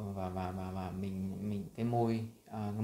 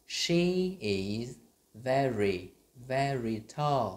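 "very" stretched and punched, slow and louder; slow stressed very; 2 semitones higher, 4 dB louder, much slower than usual. Shown as a demonstration. Teacher drilling very for the class. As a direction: lean hard on each "very". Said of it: "cùng đọc với mình".

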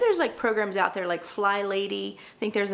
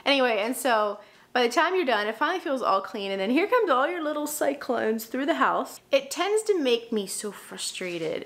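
ordinary talk, nothing marked

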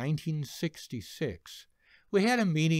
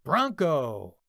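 shouted company cry; shouting; 5 dB louder than usual. Retold or imitated. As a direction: yell it as a unit. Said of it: "we would all jump up and yell".

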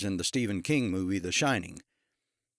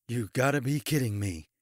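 muttered. A narrator's reading. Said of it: "Chesney muttered".

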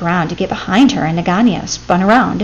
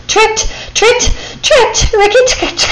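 rising shrieks, high and loud; climbing higher with each cry; shrieking; 13 semitones higher, 4 dB louder than usual. A narrator's reading. Tricked cobra shrieks at the mongoose, rising high and loud.

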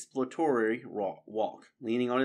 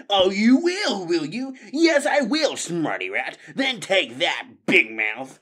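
higher, louder mocking yell; brawling shout; 9 semitones higher, 9 dB louder, slower than usual. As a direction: taunt him mid-fight. Rats brawling.

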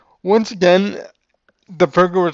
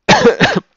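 sudden cough mid-sentence; 5 dB louder than usual. Real, not acted. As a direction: cough sharply, then carry on. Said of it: "I'm coughing because".

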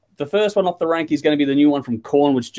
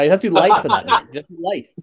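laughing; 3 semitones higher, faster than usual. Real, not acted. Joking back at the Axel impression.

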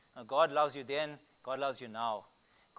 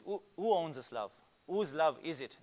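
usual speaking voice

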